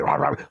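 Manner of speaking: short rough bark noise; imitated dog barking. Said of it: "the dog was like".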